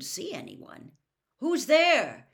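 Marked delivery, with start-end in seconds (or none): 1.4-2.3 raised, higher call into the dark. A narrator's reading. Harry challenges an unseen conjurer in the dark, calling out. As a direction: call out louder, pitched up. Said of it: "he called again".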